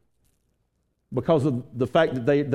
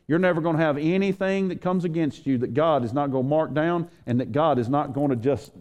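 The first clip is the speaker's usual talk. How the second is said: ordinary delivery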